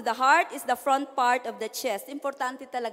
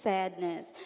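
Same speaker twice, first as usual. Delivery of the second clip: soft, low, sorrowful word; sad voice; 6 semitones lower, 7 dB quieter than usual. Shown as a demonstration. Teacher shows sadness to the class, soft and low. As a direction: let it sink with sadness. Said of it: "let's say it with emotion".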